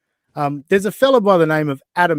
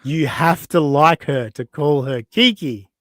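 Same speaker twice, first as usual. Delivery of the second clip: ordinary delivery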